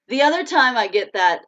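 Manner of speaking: usual speaking voice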